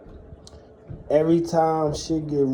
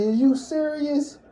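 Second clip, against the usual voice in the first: pitched way up in shocked disbelief; stunned disbelief; 12 semitones higher than usual. Retold or imitated. To his mom in the crowded store, shocked high disbelief. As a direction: replay the stunned reaction, higher.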